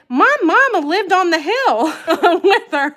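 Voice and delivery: put-on high, loud laughing Southern accent; Tennessee accent. Streamer retelling her cousin's message in a Southern accent. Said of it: "so I could just hear her accent".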